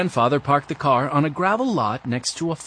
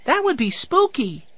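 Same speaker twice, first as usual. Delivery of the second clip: put-on high-pitched eager six-year-old; small boy's excited voice; excited little boy; 12 semitones higher than usual. A narrator's reading. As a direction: pipe up high, thrilled by the idea. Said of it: "cried Benny".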